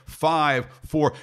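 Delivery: ordinary talk, nothing marked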